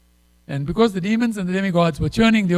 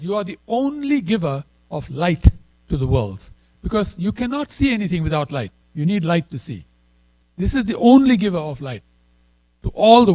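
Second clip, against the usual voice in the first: rising, louder quoting of the verse; scripture recitation; 2 semitones higher, 4 dB louder than usual. Acted. Preacher reads the verse to the devotees, building louder. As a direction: proclaim the verse to the whole hall.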